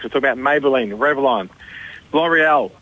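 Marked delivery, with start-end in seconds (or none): none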